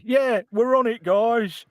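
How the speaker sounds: a lightly voiced bad line reading; badly delivered line. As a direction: ham up a badly acted line. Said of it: "line is delivered really badly".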